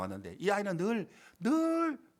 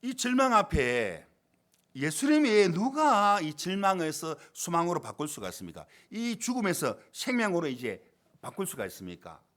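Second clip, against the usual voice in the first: impassioned preaching; 4 semitones higher, 6 dB louder than usual. Real, not acted. Preacher asking the congregation who can change despair, impassioned preaching. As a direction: preach it out with full force.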